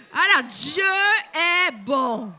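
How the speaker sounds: loud proclamation of Dieu est bon; rousing shout. Shown as a demonstration. Preacher leading the church in a declaration, shouted. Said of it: "Dis-lui avec conviction".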